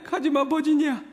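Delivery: high, loud, pleading "가지마, 버지니아"; desperate plea. Acted. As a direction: beg her not to go. Ghost begs Virginia to stay.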